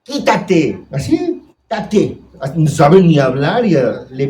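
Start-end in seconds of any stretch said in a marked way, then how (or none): 0.0-2.2 put-on high childish "quítate" voice; imitating a small child. Preacher imitating a toddler pushing his mother away, high pitched. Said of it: "que le pegan a su mamá".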